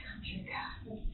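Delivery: breathy hushed whisper under the breath; a hushed whisper at bedtime prayers; whispered aside. Retold or imitated. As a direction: mutter it to yourself in a whisper.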